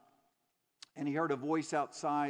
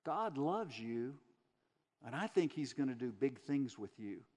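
put-on soft Southern accent; 3 semitones lower than usual. Church lady to a prisoner in his cell, soft Southern accent. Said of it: "in her soft Southern accent".

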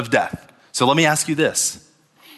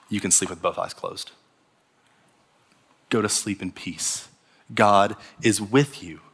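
much softer, gentle and calm; soft reassuring voice of faith; 5 semitones lower, 5 dB quieter than usual. Acted. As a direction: hush them to sleep like faith itself.

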